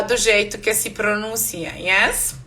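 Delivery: ordinary talk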